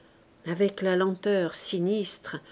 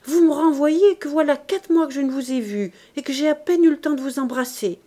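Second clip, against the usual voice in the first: high, louder, hurried pouting complaint; simpering spoiled child; 6 semitones higher, 6 dB louder than usual. A narrator's reading. Daughter protesting at being sent away, pouty simper. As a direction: plead like a coy, spoiled child. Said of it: "avec une minauderie d'enfant gâté".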